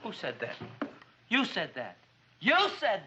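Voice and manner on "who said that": low, soft, startled question; hushed, uneasy query; wary. Acted. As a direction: ask warily into the empty room. Startled by an unknown voice.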